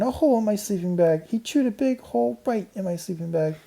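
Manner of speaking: put-on high outraged girl voice. A narrator's reading. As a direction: cry out in dismay. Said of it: "shrieked Karen".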